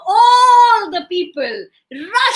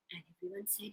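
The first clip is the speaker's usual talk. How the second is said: plain reading voice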